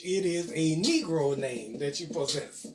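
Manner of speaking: usual speaking voice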